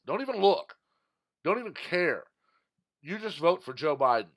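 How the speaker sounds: loud, high, mocking demand; hammed-up loud order to voters; mocking shout. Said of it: "he's literally telling the American people".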